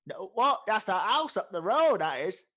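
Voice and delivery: loud, high, confused protest; baffled orc innkeeper. Acted. Orc barkeep corrects newcomer about Kingshire, loudly baffled. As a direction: correct him at full volume.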